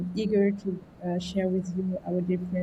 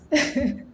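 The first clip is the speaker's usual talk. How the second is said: short laugh; 6 semitones higher, 4 dB louder than usual. Real, not acted. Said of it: "Just to add a bit of spice".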